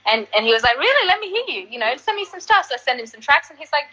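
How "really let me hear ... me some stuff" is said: high, intrigued mock voice; curious, inviting imitation; playful impression of the bassist. [name] voiced in story.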